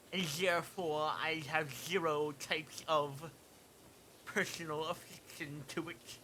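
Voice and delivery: hammed-up nerd, stretching the words out. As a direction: state it like a pedantic nerd. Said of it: "nerd voice there".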